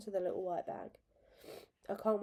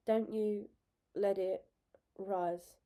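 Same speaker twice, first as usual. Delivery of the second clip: strained; 3 dB louder, much slower than usual. A narrator's reading. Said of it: "she gets out".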